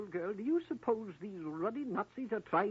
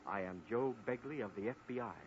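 much lower, softer American agent voice; changed voice; 11 semitones lower, 8 dB quieter than usual. Acted. FBI agent revealing himself to Jane, much lower and softer. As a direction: drop the act and speak as the real lawman. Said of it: "you've changed your voice".

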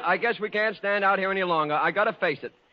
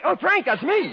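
excited realization; 10 semitones higher, 4 dB louder than usual. Acted. Realizing the girls mean him.

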